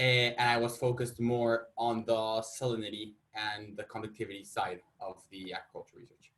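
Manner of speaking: usual speaking voice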